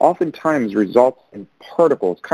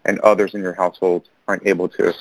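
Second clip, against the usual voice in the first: ordinary delivery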